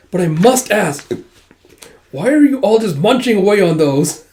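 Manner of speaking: high, loud, panicked groveling; flustered frantic apology. Retold or imitated. Nobleman groveling to the princess, high and flustered.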